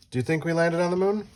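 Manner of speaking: usual speaking voice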